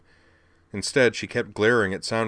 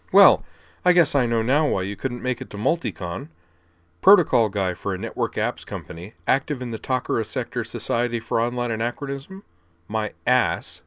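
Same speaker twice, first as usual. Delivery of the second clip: higher, louder scornful jab; scowling sarcasm; 4 semitones higher, 4 dB louder than usual. Acted. Sylvie scowling at Soundwave's lies, scornful. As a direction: scoff at his fake résumé, scowling.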